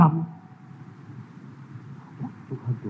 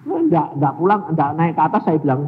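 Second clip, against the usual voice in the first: ordinary talk, nothing marked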